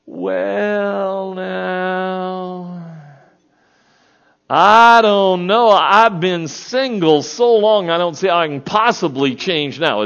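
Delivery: high, slow, dithering voice; mock-hesitant bride hemming and hawing; hesitant reluctant reply. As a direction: stall like someone afraid to commit. Acted hesitant reply to proposal.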